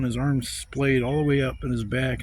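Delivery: ordinary delivery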